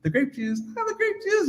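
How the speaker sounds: sweetly forced politeness, rising high on the end; fake-nice warning through a tight smile; passive aggressive. Host warning guest's parents about grape juice, passive aggressive. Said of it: "'cause you're really passive aggressive".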